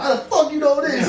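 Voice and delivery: high, loud laughing squeal of disbelief; mock stunned disbelief. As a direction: squeal it through a laugh, amazed. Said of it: "And they're like".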